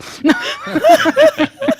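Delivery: shrieking high laugh; high laughing fit. Real, not acted. Laughing hard at own joke.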